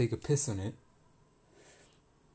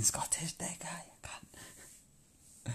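whispered insult; 6 semitones higher, 7 dB quieter, much slower than usual. Real, not acted. Bitter whispered insult about ex.

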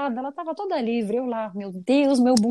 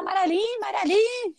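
high sing-song call at a friend's gate; 9 semitones higher than usual. Retold or imitated. Child calling her friend from outside the gate, high sing-song. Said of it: "Cheguei lá".

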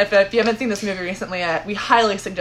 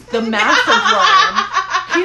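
laughing; 11 semitones higher, 7 dB louder, much slower than usual. Retold or imitated. Mimicking the huge worm, laughing.

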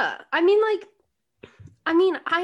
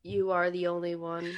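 lower, softer, deadpan; flat, lifeless delivery; mock emotionless; 4 semitones lower, 6 dB quieter than usual. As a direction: read it like a bored robot. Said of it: "more emotion from a cheese sandwich".

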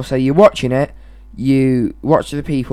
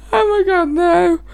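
high, tearful laughing voice; helpless laughter; 13 semitones higher than usual. Real, not acted. Girlfriend reacting to boyfriend's accent, helpless high laughter. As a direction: gasp it out through laughter.

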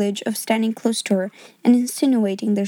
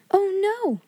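put-on a higher, startled little girl refusing; 9 semitones higher than usual. A narrator's reading. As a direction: gasp out a polite refusal. Sarah refuses the coin.

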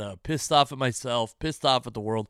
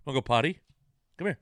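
coaxing the dog; much faster than usual. Real, not acted.

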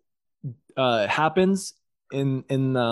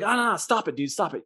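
higher-pitched hurried protest; mock alarm; 6 semitones higher, faster than usual. God stopping Gabriel's advances, higher mock-alarmed protest. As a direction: fend someone off, pitched up.

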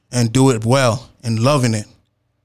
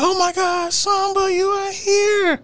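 much higher, slow gushing wife voice; surprised wife; slower than usual. Retold or imitated. Wife greeting the poet at her party, high gushing surprise. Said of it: "You're like".

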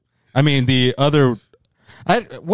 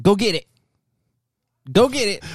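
rising mock order, like calling a dog; mocking command; 6 semitones higher than usual. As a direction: goad him to fetch it, voice climbing. Mock command to man fetching coin.

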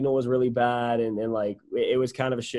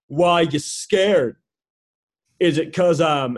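imitating the stranger; 3 semitones higher, 7 dB louder, slower than usual. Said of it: "And he's like".

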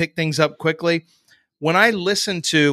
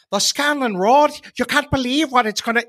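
high, lilting over-the-top Irish accent; leprechaun Irish accent; 8 semitones higher than usual. Shown as a demonstration. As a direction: do a cartoon Irish leprechaun brogue. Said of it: "you shouldn't do a leprechaun voice".

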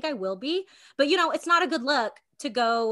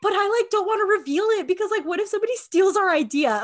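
excited secrecy; 9 semitones higher, 7 dB louder, faster than usual. Acted. Joking about patent secrecy.

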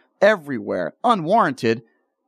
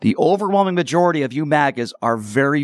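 normal talking voice

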